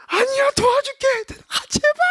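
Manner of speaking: high, loud mock plea; squeaky pleading to be let help; pleading husband. Husband begging the doctor to stay, high squeaky plea.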